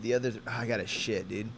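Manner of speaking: normal talking voice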